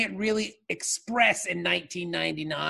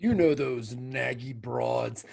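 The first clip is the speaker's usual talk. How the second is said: bro voice; 7 semitones lower, 3 dB quieter than usual. Pretend. Bro persona line.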